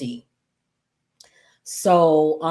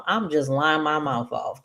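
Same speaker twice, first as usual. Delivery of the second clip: ordinary talk, nothing marked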